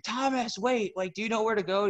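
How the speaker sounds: calling a teammate across the mall, pitched high. Retold or imitated. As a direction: shout after Thomas, high and urgent.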